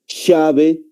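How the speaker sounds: "llave" with an initial "sh" sound; Argentine pronunciation. Shown as a demonstration. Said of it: "Un argentino".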